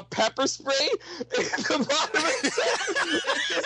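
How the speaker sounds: high-pitched, laughing through the words; cracking up while telling the story. Real, not acted. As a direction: lose it mid-sentence. Cracking up telling the pepper spray.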